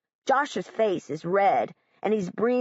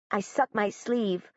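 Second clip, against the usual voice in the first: ordinary delivery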